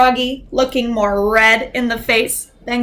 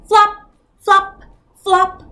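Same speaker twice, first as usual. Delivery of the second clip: flop sound effect; 6 semitones higher, 4 dB louder than usual. A narrator's reading. Sound effect of flopping feet.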